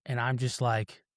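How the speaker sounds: ordinary talk, nothing marked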